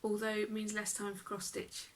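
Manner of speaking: usual speaking voice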